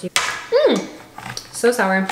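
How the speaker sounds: puckered mm at a sour taste; sour face. Real, not acted. Tasting sour pomegranate molasses.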